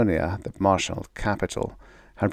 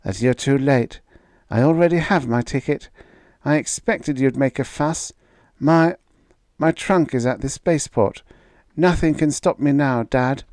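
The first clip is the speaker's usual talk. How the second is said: put-on higher, earnest young daughter; a daughter defending her fiancé, pitched higher; young woman's voice; 6 semitones higher than usual. A narrator's reading. Daughter defending Gregrill to her father, higher young voice.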